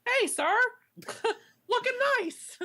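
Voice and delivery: high-pitched mock greeting; silly high hello to a star; awkward fan greeting. Imagined greeting to the team captain.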